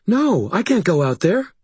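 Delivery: high, panicky protest; frightened refusal. A narrator's reading. Sarissa refusing to go out to Maeve, panicky.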